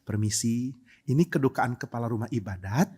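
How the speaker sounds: soft, low, timid politeness; mild, polite. Acted. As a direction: excuse yourself meekly at a mourning house. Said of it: "Tokoh yang santun". (santun, pelan dan lembut)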